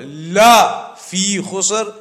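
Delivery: la drawn out slowly, then a break; stress on la. Shown as a demonstration. Preacher isolating the lam in that word, stress on la. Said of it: "the la in Arabic".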